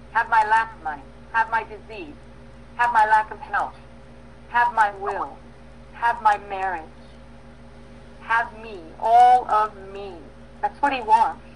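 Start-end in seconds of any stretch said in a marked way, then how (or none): 4.5-10.1 slow fervent surrender. Real, not acted. Prayer of total surrender.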